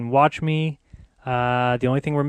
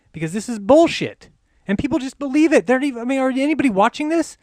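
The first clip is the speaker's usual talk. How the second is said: higher and louder, fuming; livid; 9 semitones higher, 3 dB louder, faster than usual. Real, not acted. Telling viewers why he is livid, fuming and high. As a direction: let the outrage spill over. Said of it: "why I'm livid".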